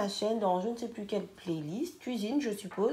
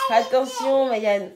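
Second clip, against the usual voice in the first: laughing warning; 4 semitones higher, 11 dB louder, slower than usual. Real, not acted. Warning child while laughing.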